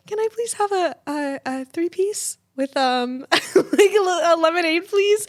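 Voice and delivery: high, wobbly tearful voice; trying to hold it together while ordering; crying order. Retold or imitated. Ordering at the drive-thru while sobbing, high and teary. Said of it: "I roll down the window and I go".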